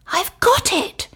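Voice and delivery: high, sudden eureka cry; excited cry. Acted. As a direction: burst out with the idea. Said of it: "she cried".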